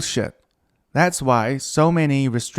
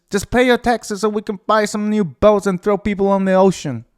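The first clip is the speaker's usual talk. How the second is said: higher, louder Brooklyn mobster; Brooklyn gangster; 8 semitones higher, 6 dB louder than usual. Retold or imitated. Mock Brooklyn gangster boasting about the tax money. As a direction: push it big, mobster with a grin. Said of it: "it's not very Brooklyn".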